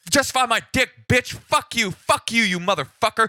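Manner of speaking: hammed-up furious yelling; enraged caller. Angry caller cursing at the police officer, screaming. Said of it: "he's just going like".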